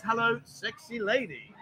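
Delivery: lightly imitating a smooth flirt, soft and slow; imitating Ryan. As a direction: drop the volume and purr it like a would-be charmer. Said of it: "Ryan says".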